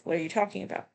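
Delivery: normal talking voice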